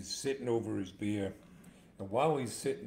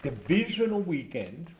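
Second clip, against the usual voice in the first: higher, louder sportscast billing; emphatic announcement; 5 semitones higher, 7 dB louder than usual. Real, not acted. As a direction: announce DIVISIONAL WEEKEND with punch. Announces the round, then repeats it.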